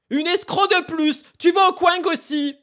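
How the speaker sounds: high, loud, slow banishing shout; mock shout. Real, not acted. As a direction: yell them off to the Kwang. Banishing chat user, shouting.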